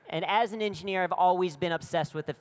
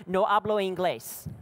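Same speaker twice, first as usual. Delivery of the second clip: blurted Spanish; 3 semitones higher than usual. Retold or imitated. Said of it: "I just blurted out".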